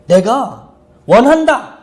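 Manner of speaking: acted ringing answer, each word drawn out; firm declaration. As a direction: declare it slowly and forcefully. Acts out Jesus answering the leper.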